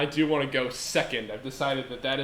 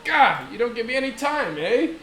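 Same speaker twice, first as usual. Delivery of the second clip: mock Canadian; 8 semitones higher, 4 dB louder than usual.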